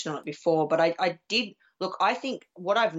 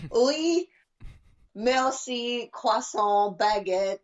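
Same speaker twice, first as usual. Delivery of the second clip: mock French words; 6 semitones higher, much slower than usual. Shown as a demonstration. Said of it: "and I can say".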